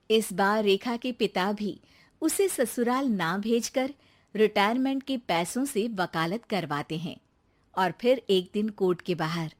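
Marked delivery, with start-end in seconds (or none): none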